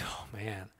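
low, very soft retold groan of dread; quiet dismay. Parent hearing bad news of a child, hushed dismay. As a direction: let it sink, hushed and low.

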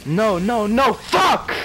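loud, climbing cries of no; high, real gamer frustration; frustrated yelling. Real, not acted. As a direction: shout as the match slips away. Gamer losing the match.

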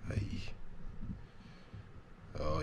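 ordinary talk, nothing marked

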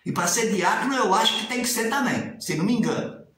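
ordinary talk, nothing marked

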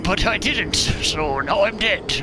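loud, exasperated rant about dying; loud frustration. Real, not acted. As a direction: vent loudly at the game. Frustrated after dying in game.

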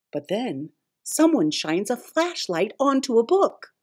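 plain reading voice

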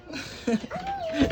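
high, soft burst of laughter; amused laugh. Real, not acted. Vlogger laughs at how the child looks, high giggle. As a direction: giggle high and quietly at the sight.

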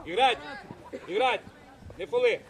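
loud, short sideline commands; shouted orders. Real, not acted. Coach ordering players to play without fouling, shouting. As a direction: fire out each order at full voice.

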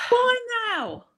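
very high, stretched mock-baffled cry; hammed-up bewildered protest; mock wail. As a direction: wail it high in comic disbelief. Retold thought on being banned.

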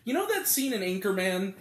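ordinary talk

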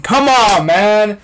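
loud, high-pitched complaint; exasperated protest. Real, not acted. Complains about missing Russian accent.

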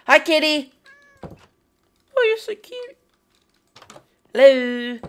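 high cutesy coo; cooing at a kitty. Real, not acted. Player greeting a cat in a high cutesy coo.

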